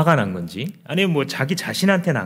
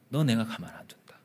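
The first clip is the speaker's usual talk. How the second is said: whispered threat, falling at the end; menacing whisper; 10 dB quieter than usual. Acted. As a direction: hiss the threat under your breath. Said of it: "그 표정은 무슨 표정입니까?". (낮게 속삭이는 위협)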